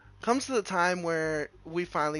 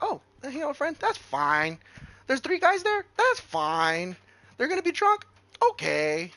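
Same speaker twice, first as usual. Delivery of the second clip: mock naive voice; 9 semitones higher, 5 dB louder, slower than usual. Mocking his naive trusting self.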